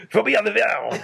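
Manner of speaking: high, loud mock French as if about to throw up; retching French. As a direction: gag out "Phobia de vert" like a hammed-up Frenchman. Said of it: "say like you're about to throw up".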